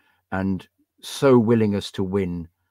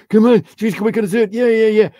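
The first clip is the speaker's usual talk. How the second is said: imitating his own cheering, much higher and louder; mock cheering; 13 semitones higher, 8 dB louder than usual. Fan acting out how he roars on his team mid-match, excited yelling. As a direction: belt it out like a fan urging the team on.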